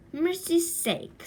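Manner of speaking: high, slow surprised aunt; surprised exclamation. Acted. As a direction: gasp it out like a startled aunt.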